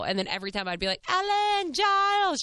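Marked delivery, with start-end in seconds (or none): 1.1-2.4 high, loud singsong hail; playful high-pitched call, imitating herself; retold calling out. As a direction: holler the names flirtily across a bar.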